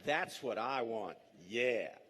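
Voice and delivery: mock-relish with a laugh, slow and dry; sarcastic. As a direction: savor the germs with exaggerated sarcasm. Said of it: "and I say".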